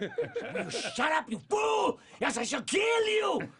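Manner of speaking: high, loud, slow shouting with laughter; Ren's screaming rage. Acted. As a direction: shriek it at full tilt. Ren screaming at Stimpy.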